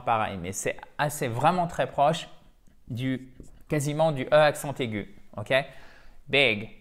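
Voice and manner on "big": ordinary talk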